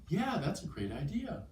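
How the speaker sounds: interested. Shown as a demonstration. Teacher demonstrating someone keen on a suggestion, interested. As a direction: take up the idea with clear interest. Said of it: "whether someone is interested in doing something".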